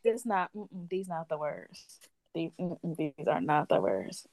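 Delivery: quiet, under her breath; hushed muttering. Retold or imitated. Whispering to herself in line that these are not the words, hushed. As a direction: mutter it to yourself, almost a whisper.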